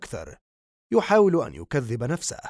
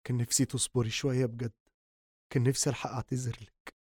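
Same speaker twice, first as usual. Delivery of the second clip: much softer, fast broken confession; grieving; 4 dB quieter, faster than usual. Acted. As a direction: pour out regret quietly, fast. Son grieves beside dead mother.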